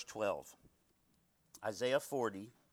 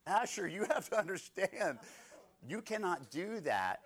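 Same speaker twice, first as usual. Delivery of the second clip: stern scolding; 6 semitones higher than usual. Retold or imitated. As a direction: drive the lesson home. Scolding his toddler son.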